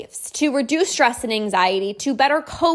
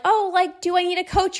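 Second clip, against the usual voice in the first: mock doubtful client; 7 semitones higher than usual. Mocking a doubtful potential client.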